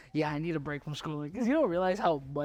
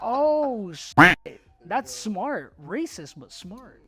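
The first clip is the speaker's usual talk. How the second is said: sudden loud, high exclamation; shocked outburst, then a wry verdict; surprised; 7 semitones higher, 11 dB louder, much slower than usual. Retold or imitated. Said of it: "And I was like".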